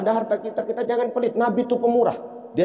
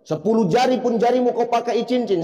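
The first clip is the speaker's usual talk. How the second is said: normal talking voice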